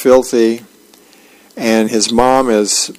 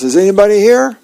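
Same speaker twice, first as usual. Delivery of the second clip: mild yell; 9 semitones higher, faster than usual. Acted. Dagwood calling into an empty house, mild yell. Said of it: "and he yells".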